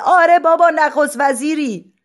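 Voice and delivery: high shout in unison; crowd shouting. Acted. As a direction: yell it up to him together. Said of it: "یک صدا فریاد کرد".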